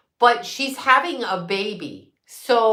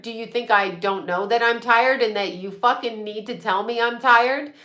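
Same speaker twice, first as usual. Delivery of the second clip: ordinary talk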